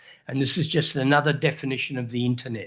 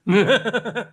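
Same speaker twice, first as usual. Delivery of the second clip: real quick, high chuckle; short burst of bubbling laughter; amused chuckle; 5 semitones higher, 4 dB louder than usual. Real, not acted. Speaker amused by own creepy-people remark, chuckling.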